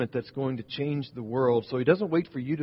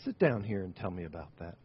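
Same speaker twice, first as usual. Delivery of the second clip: gentle invitation; 5 semitones lower, 6 dB quieter than usual. Retold or imitated. Mother gently invites grieving man.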